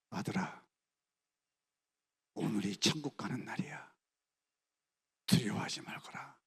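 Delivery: soft, breathless whisper of comfort; mother whispering to her son at the gallows; tender whisper. Retold or imitated. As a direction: soothe the frightened son. Mother comforts son before death.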